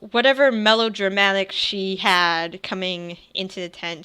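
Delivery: usual speaking voice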